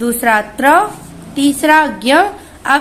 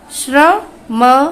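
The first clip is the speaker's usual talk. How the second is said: usual speaking voice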